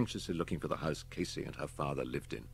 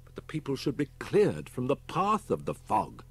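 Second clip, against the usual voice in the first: dismayed; 7 semitones higher, 4 dB louder than usual. Acted.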